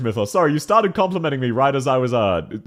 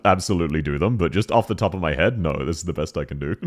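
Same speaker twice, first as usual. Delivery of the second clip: usual speaking voice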